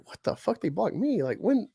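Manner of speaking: acted disbelief, rising high; mock shock at being blocked.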